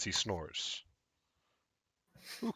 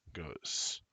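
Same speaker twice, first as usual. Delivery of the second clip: ordinary talk, nothing marked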